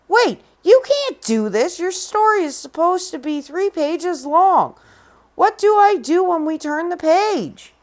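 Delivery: high, alarmed pleading; panicked Jack. Acted. Jack begging Red not to leave, panicked.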